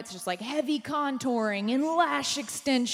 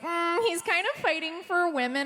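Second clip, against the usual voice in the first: mock swooning; 6 semitones higher than usual.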